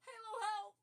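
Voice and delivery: high, loud cry for help; shouting for help. Real, not acted. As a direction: call out in a panic. Panics that stream audio is quiet.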